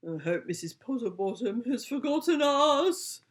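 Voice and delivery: a lightly voiced dog's hopeful whine; whining dog. Dog whines to his owner outside the shop. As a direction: whine it, worried and hoping. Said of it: "whined Clang".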